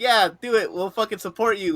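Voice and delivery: high, loud hype; retold cheering. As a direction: egg him on loudly as a supporter. Retold hyping up of a cosplayer.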